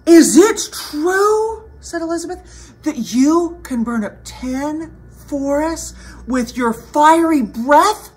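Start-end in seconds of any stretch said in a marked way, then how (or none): 0.1-1.7 high, slow, loud question; flattering princess. Acted. Elizabeth goads the dragon. 2.8-8.1 slightly raised, wondering question; storybook princess, pitch lifting at the end; flattering princess. Acted. Elizabeth goads the dragon.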